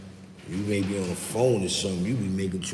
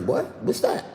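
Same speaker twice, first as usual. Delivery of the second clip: mock confused southerner; 7 semitones higher, slower than usual. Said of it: "They be like".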